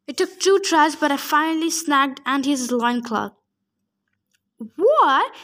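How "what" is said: a startled, high, loud "What?"; sudden shocked giant cry; surprised yell. A narrator's reading. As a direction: yelp it out, caught off guard. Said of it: "he yelled".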